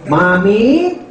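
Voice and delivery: mock nasal cleft-palate voice, rising; hammed-up nasal speech; nasal speech impression. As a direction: imitate the ngongo talking. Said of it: "ng ngongo".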